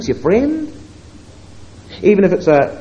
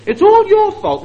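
mock accusation; 12 semitones higher, 6 dB louder than usual. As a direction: fling the blame at him, pointing. Sarai's blaming quote repeated.

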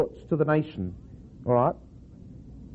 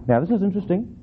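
normal talking voice

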